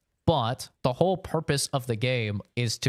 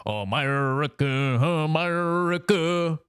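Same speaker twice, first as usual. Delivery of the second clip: drawn-out patriotic cry; 6 semitones higher, 4 dB louder, slower than usual. Retold or imitated. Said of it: "they'd be like".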